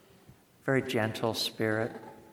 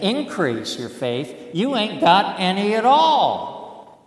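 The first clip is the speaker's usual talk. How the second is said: teasing Jesus voice; 9 semitones higher, 8 dB louder than usual. Retold or imitated. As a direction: rib them with a grin.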